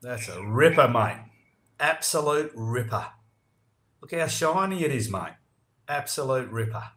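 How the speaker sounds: best Aussie. Shown as a demonstration. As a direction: show the Yanks how it's done. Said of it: "give us your best one".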